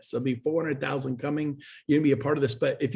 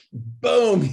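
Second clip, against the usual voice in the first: a sudden loud high "boom"; 7 semitones higher, 7 dB louder than usual. Real, not acted. Guest on dropping bad clients, a loud sudden boom. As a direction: punch the word "boom" out. Exclamation mid-story.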